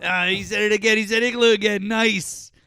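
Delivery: high, loud delighted outburst; gleeful excitement. Real, not acted. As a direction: crow with delight at catching him out. Gleeful that he repeated igloo.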